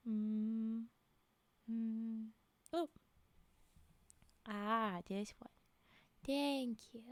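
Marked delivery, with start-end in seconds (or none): none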